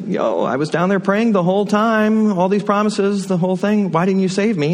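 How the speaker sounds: drowned man complaining to God in heaven, aggrieved; angry and disappointed. Retold or imitated. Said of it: "feeling a little angry and disappointed".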